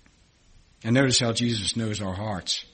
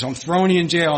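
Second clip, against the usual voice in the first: raised, louder master threatening jail; mock stern threat; 5 semitones higher, 6 dB louder, faster than usual. Preacher voices the master threatening the debtor, loud and stern. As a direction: bark it like the angry master.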